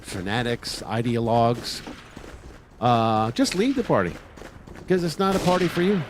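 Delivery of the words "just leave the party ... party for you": forceful urging. Shown as a demonstration. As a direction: push the listener hard to leave the party. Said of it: "You say it strongly".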